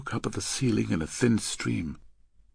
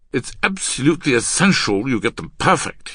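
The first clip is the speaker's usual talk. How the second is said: loud, higher, emphatic lecture; stern insistence; 6 semitones higher, 7 dB louder than usual. Acted. Man insisting his partner get signals perfect, stern. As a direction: press the point forcefully.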